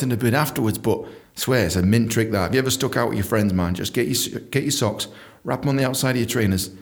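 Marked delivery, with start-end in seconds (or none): none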